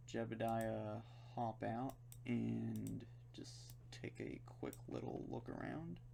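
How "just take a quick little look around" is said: hushed playful whisper. Pretend. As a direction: whisper it like sneaking around. Sneaking a look on the planet.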